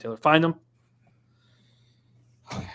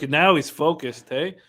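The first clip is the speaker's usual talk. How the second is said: normal talking voice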